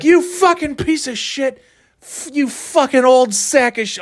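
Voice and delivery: high, slow furious mock scream; mock screaming rant. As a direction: hammer each insult out slowly, shrieking. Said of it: "And I'm like".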